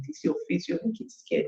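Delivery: put-on soft, hushed voice to a frightened child; mother gently asking her scared daughter; hushed gentle question. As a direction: whisper it kindly, close and quiet.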